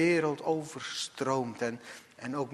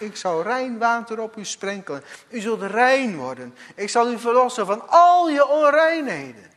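building louder as God; voicing God's promise; 7 semitones higher, 8 dB louder than usual. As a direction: promise it swelling to a cry. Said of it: "bij de Heer". (lichtjes de stem van God)

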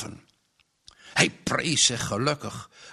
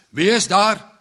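higher, louder angry challenge; angry call; 6 semitones higher, 6 dB louder than usual. A narrator's reading. Woken farmer challenging whoever rattles his back door, angry call. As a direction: call out sharply, annoyed. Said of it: "riep hij boos".

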